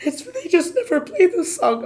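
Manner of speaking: high, loud, frantic voice with the pitch well up; hysterical. Retold or imitated. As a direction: blurt it out overexcited, near tears. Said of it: "Okay, I'm hysterical".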